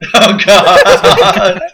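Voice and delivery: laughing. Real, not acted. Laughing at the video.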